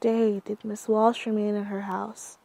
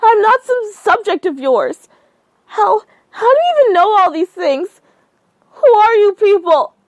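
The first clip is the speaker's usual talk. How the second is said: high, loud crying panic; woman screaming in terror on the phone; tearful screaming; 11 semitones higher, 13 dB louder than usual. Acted. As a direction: scream through rising tears. Panicked tearful rage at caller.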